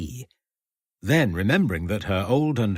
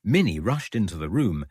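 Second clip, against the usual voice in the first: ordinary delivery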